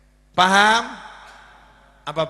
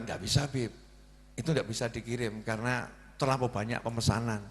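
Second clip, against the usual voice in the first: quiet, hesitant refusal on the phone; a timid clerk saying no; hesitant employee; 7 semitones lower, 10 dB quieter than usual. Retold or imitated. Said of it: "nada bicara orang kebanyakan mikir". (karyawan ragu-ragu menolak dengan pelan)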